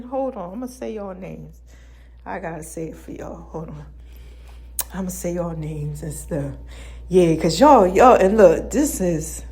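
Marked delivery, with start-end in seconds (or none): 0.0-6.5 much softer, lower mumbling aside; quiet mutter. Real, not acted. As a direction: mumble to yourself while looking. Muttering to self while searching names.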